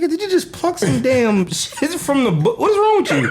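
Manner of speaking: high-pitched incredulous retort, laughing midway; retold disbelief. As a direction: act out a baffled reaction, pitch leaping up. Retelling his reaction to homie picking food.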